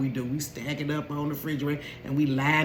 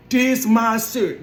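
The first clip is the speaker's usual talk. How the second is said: loud, slow, higher proclamation; son's loud announcement; 5 semitones higher, 6 dB louder, slower than usual. Retold or imitated. Son announcing to the household, loud and slow. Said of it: "make an announcement throughout the house".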